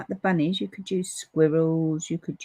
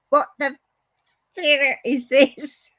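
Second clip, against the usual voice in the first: loud, high retold disbelief breaking into laughter; laughing impression of an appalled reaction; mock outrage; 6 semitones higher, 5 dB louder, slower than usual. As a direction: act out his baffled outburst, slowing at the end. Retelling Paul's reaction, laughing.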